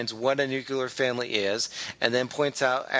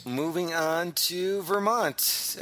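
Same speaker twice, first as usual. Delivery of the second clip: announcer segue; 5 semitones higher, much slower than usual. Acted. Announcer transition.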